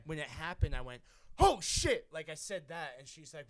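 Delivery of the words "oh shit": loud, high retold "oh shit"; startled blurt. Telling a friend about seeing the rat, high startled blurt. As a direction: blurt it out as the rat runs past. Rat runs by during sex.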